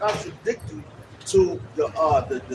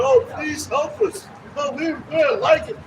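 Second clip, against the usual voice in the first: high, drawn-out mock plea; exaggerated helpless begging; mock pleading; 6 semitones higher, 3 dB louder than usual. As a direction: imitate someone begging for help. Mimicking people begging for help.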